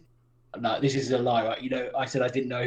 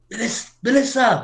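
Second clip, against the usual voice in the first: shouting a name; 8 semitones higher, 4 dB louder than usual. Acted. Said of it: "I'll shout Melissa's name really loud".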